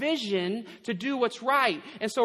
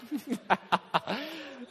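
laugh; 4 semitones higher, 5 dB quieter than usual. Real, not acted. Still laughing.

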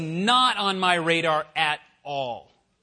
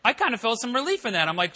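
ordinary talk, nothing marked

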